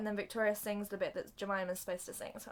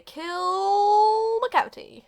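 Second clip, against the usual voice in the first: loud, high, long-held cry; mock dramatic shout; 11 semitones higher, 12 dB louder, much slower than usual. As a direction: bellow it, stretching the verb. Joke claim bellowed.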